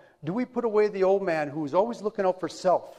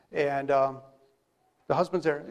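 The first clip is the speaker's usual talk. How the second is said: ordinary talk, nothing marked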